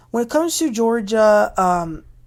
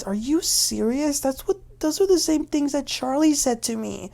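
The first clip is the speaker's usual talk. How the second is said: retold disbelief; 8 semitones higher than usual. Georgia stunned by Riley's insult.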